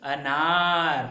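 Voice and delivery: अनार stretched very slowly, each sound drawn out; slow model word. Shown as a demonstration. Teacher drawing out अनार for pupils to echo. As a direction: say अनार slowly for children to repeat. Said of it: "आपको भी उच्चारण करना है".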